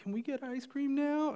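high little-girl imitation, sweetly asking; cute child voice. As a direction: mimic a daughter angling for a treat, very high-pitched. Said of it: "she goes".

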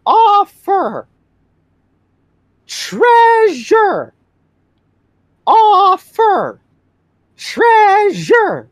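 each word slow and apart; slow, repeated. Acted. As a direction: spell it out slowly to a stranger. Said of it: "repeatedly and very slowly say".

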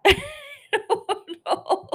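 high, helpless real laughter through the words; laughing. Real, not acted. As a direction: laugh while admitting confusion. Laughs at her own confusion.

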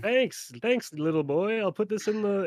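a hint of put-on politeness to a little boy; Santa actor humouring a child. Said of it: "And he's like".

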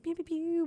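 soft synth pew sounds made with the mouth; soft mock synth noises; imitated pew pews. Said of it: "sounds like little pew pews".

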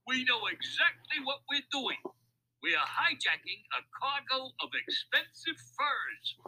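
high crook voice, boastful call; calling back. A narrator's reading. Louis tells Mac about the hijacking, calling back. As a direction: boast it high over your shoulder. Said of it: "Louis called back over his shoulder".

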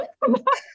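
bright high burst of laughter. Real, not acted. Host laughing at herself mid-question, high laugh. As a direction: laugh at losing the thread.

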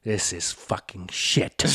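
low, slow, gruff dad verdict; imitating his dad. Dad judging the video his son showed, low and slow. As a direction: drag it out low like an unimpressed father. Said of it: "He looks at me, he goes".

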